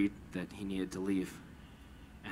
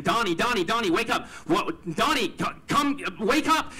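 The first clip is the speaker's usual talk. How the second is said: much higher and louder, panicked pleading; desperate cries of his name; frantic calling; 11 semitones higher, 7 dB louder than usual. Retold or imitated. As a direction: shake him awake in rising panic. Shaking unconscious friend, frantic.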